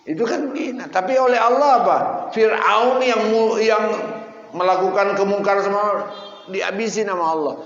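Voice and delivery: very high, loud, protesting sermon voice; heated preaching. Real, not acted. Preacher asking why the idol-maker was spared, heated and loud. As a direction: push the voice up in incredulous protest.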